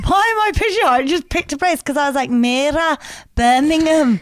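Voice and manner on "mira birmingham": a light put-on Birmingham accent. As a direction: say it like a Brummie, lightly. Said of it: "Whenever you do that accent".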